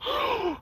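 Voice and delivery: high shocked gasp; mock gasp. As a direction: gasp like you're scandalized. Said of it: "feeling like".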